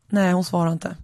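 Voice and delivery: ordinary delivery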